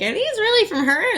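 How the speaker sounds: high, disbelieving question; imitating a doubtful friend; mock incredulous friend.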